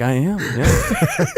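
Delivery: high, slow, laughing I am yeah; laughing admission. Retold or imitated. Soldier owning his failed exam to teasers, laughing. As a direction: drag it out through a laugh. Self-mocking reply to being called dumb.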